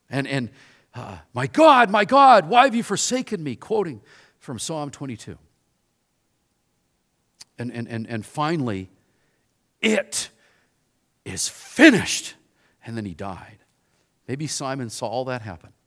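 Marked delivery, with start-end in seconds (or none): 1.3-3.6 loud, high cry of abandonment; Jesus forsaken on the cross, loud and high; anguished cry. Retold or imitated. 9.8-12.3 slow, drawn-out last breath; Jesus dying on the cross, slow and solemn; final words. Retold or imitated.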